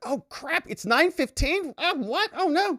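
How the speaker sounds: hammed-up high flustered clerk voice; panicked clerk. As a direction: play the clerk who overslept. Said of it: "She's like".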